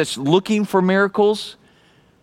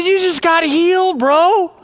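joyful announcement; 11 semitones higher than usual. Retold or imitated. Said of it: "I said".

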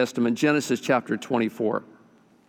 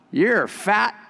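higher mocking parrot voice; parrot insult; 6 semitones higher than usual. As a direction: sneer like a rude bird.

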